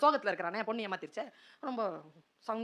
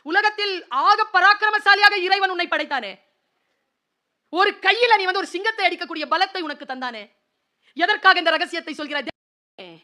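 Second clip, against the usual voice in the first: anguished cry; 8 semitones higher, 13 dB louder than usual. Retold or imitated. Preacher cries out to Samson over his betrayed secret, high and loud. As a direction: wail it at Samson like a heartbroken elder. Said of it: "ஐயோன்னு நினைச்சேன் நான்".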